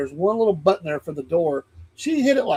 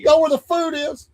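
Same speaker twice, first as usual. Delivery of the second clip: screaming announcer; 10 semitones higher than usual. Retold or imitated. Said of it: "screaming in the microphone".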